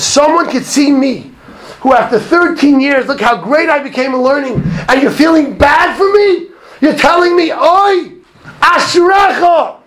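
high-pitched indignant cry, imitated; retold screaming. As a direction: protest at the top of your range as him. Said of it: "He started screaming and he says".

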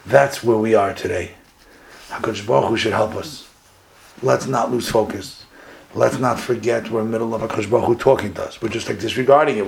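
ordinary delivery

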